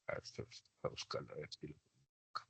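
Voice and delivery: very soft, barely audible aside; talking to oneself. Real, not acted. Teacher looking for the family vocabulary, murmuring to self. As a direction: murmur it to yourself while searching.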